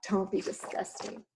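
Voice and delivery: whispered cheeky echo of don't be disgusting. Acted. Jack echoing Missus Giant in a sly whisper. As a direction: echo her under your breath. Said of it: "said Jack".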